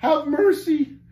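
high, loud plea; piteous blubbering. A narrator's reading. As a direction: wail piteously for mercy. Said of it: "blubbered one of the giants piteously".

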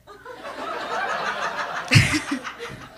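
high airy laugh. Real, not acted. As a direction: burst into a laugh. Laughing at story.

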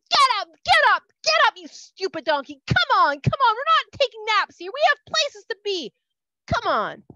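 loud, high, furious yelling; Bilam, hopping mad, yelling at the sitting donkey. Acted. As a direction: yell at the donkey to get up. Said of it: "hopping mad".

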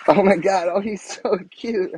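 high, giggling coo over a tiny toy; giggly squeal. Real, not acted. Gushing to her best friend about the troll, high giggly squeal. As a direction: squeal and laugh, it's too cute.

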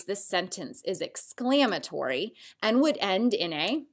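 ordinary delivery

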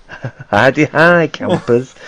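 slow, high campy greeting; holiday camp host. Pretend. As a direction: drawl it like a cheery camp host. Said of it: "You'll never be a yellow coat".

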